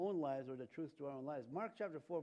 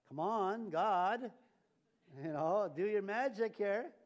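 loud, raised, stretched-out appeal to God; mock demanding; 5 semitones higher, 7 dB louder, slower than usual. People telling God to fix the storm, mock demanding. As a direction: impatiently order God about, hammed up.

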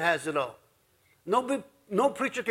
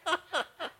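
high cackle; 11 semitones higher, 7 dB quieter than usual. Real, not acted. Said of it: "Maxine's laughing away".

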